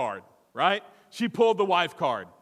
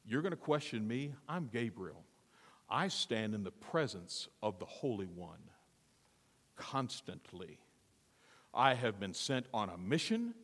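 low, soft, offended authority; quiet rebuke; 6 semitones lower, 8 dB quieter than usual. Acted. Angel to doubting Zacharias, low quiet indignation. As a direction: rebuke with hushed, lowered gravity.

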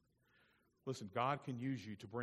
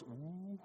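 crowd's shocked "ooh"; 9 semitones higher, 3 dB louder than usual. Retold or imitated. Everybody in the room reacting to a rebuke, mock ooh. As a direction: imitate a room gasping in scandalized ooh. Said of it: "everybody in the room goes".

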